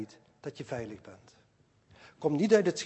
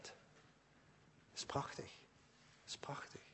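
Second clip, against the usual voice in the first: hushed, breathy whisper of wonder; soft awed whisper to the congregation; whispered awe; 21 dB quieter, faster than usual. Real, not acted. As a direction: whisper it as if it were too beautiful to say aloud. Preacher awed by the prayer.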